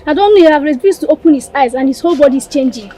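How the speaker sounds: high, loud, racing words; breakneck, frightened messenger; panicked report. Acted. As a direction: rush it out in alarm. Messenger alarmed about sick prince.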